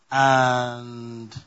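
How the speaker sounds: long, level "And", left hanging; suspenseful stretch. Real, not acted. Preacher making the congregation guess the third thing, suspenseful stretch. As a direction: hold the word out and make them wait.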